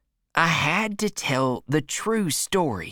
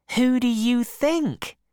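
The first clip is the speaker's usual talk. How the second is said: higher, playful aunt; teasing aunt; 7 semitones higher than usual. A narrator's reading. Aunt teasing her husband, higher and playful.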